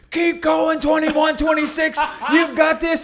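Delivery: high, loud cheering yell; cheering shout. Retold or imitated. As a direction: shout encouragement across the course. Spectator cheering him on.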